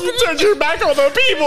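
fan accusation. Pretend. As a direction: yell at the heel from the crowd.

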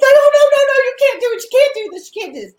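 high frantic begging, fading at the end; retold panicked plea. Daughter begging mom to stop pulling the wax. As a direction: plead in a high panic, then trail off. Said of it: "And she's like".

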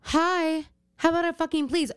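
high, mock-friendly scolding; sarcastic comeback. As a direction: fake cheer turning into a demand. Mock reply to rude customers.

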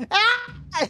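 put-on high shriek of a kid stuck in the toilet; imitated scream. Brother stuck in the toilet seat, high imitated scream. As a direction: let out a high mock yell, half laughing. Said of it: "Y él empieza a gritar".

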